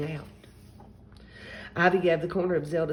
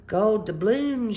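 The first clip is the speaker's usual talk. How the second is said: slow, loud, higher pirate announcement; 4 semitones higher, 6 dB louder, slower than usual. A narrator's reading. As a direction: draw it out to be noticed. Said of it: "she said loudly".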